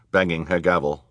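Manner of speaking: plain reading voice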